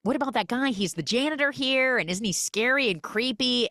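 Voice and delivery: high, excited gossip voice; gushing about the creepy janitor; gossipy friend. Retold or imitated. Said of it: "and she goes".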